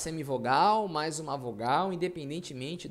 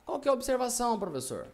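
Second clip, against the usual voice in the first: lightly voiced pupil, higher at the start; a hint of an eager student asking; curious student; 7 semitones higher, faster than usual. Student asking the professor about the observation, lightly voiced.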